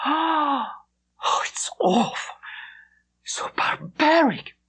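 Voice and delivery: horrified, crying whisper; tearful whisper. A narrator's reading. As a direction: breathe it out in tearful horror. Horrified girl hears of heads on walls.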